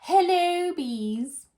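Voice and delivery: high, loud bright greeting from the queen; queen bee greeting. Acted. Queen bee greeting her class, high and loud. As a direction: call out hello like a cheery queen. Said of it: "said the queen".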